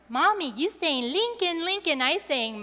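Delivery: a hint of a two-year-old retelling; little child's voice. Mother quotes her toddler explaining what happened, lightly childlike. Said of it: "He told us later".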